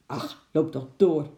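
ordinary delivery

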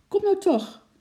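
very high, loud pleading; high-pitched, exasperated child's call; impatient cry. A narrator's reading. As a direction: urge them on with desperate impatience. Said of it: "riep Lotje, trappelend van ongeduld".

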